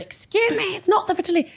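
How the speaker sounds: high, indignant imitation of her husband; mock husband protest. A wife mimicking her husband's protest, high and indignant. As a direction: squeak out the husband's huffy objection.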